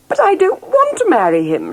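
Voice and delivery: loud, high refusal; protesting. A narrator's reading. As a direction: stamp it out like a stubborn princess. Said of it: "she protested".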